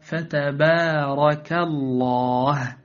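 drawn-out "فتبارك" with thin, un-emphatic ta, ba and alif; light tarqiq. Shown as a demonstration. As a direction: recite "فتبارك" slowly, vowels kept light. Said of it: "فقل". (ترقيق الباء والألف في "فتبارك")

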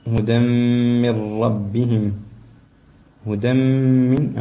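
هدى من slow, nun merged with nasal trace; partial assimilation. Shown as a demonstration. Teacher contrasting partial and full assimilation. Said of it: "هون إدغام ناقص".

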